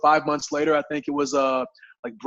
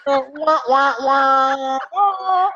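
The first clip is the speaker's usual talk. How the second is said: high, mocking sad-trombone imitation; imitated game-show fail noise, pitched high; comic failure wah-wah; 10 semitones higher, 5 dB louder, much slower than usual. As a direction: sing out a cartoon letdown sound. Imitates failure sound after punchline.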